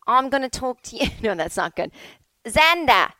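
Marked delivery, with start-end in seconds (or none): 0.1-1.1 put-on Australian accent. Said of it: "is that how you would say it". 2.5-3.1 put-on Xander in a loud, high Australian accent. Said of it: "is that how you would say it".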